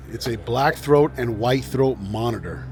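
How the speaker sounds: ordinary talk, nothing marked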